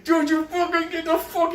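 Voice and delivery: high, frantic furious squawk; imitating a raging kid mid-fight; high mock angry outburst. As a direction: screech like a kid gone wild. B D rages while kicking.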